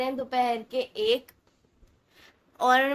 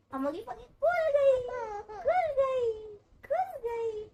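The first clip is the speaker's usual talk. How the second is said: much higher, squeaky playful voice; squeaky tease; 12 semitones higher, slower than usual. Real, not acted. Teasing baby, repeated.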